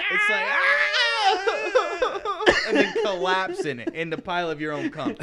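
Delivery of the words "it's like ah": high, hammed-up scream that cracks into a cry; mock scream. Friend mimics screaming on camera, mock scream. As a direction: yelp it out high and break into a fake sob. Mocking a scream while climaxing.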